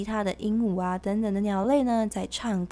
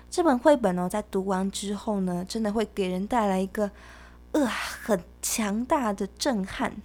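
ordinary talk, nothing marked

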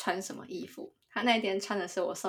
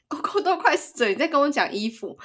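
raised, higher voice mimicking an upset boyfriend; imitated anger; 5 semitones higher, 5 dB louder than usual. Woman quoting ex-boyfriend over his dying dog, imitated anger. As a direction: retell his annoyed reproach, mildly hammed-up. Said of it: "那个时候他很生气".

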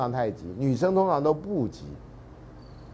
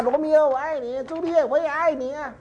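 much higher, mock fangirl squeal; screaming fans; 13 semitones higher than usual. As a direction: squeal like a starstruck fan. Mocking fans cheering the lovers. (学粉丝尖声高喊)

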